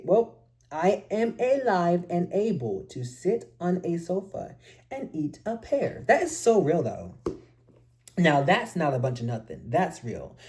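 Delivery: usual speaking voice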